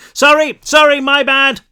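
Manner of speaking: yelled apology. A narrator's reading. Herc shouts apology after shooting at them, yelling. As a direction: yell it across the dark room. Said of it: "Herc yelled".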